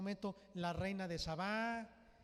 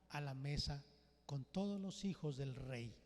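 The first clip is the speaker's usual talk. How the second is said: usual speaking voice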